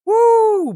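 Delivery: very high, loud woo; explosive hype whoop; loud whooping cheer. Real, not acted. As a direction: burst into a piercing whoop. Cheering for gifts.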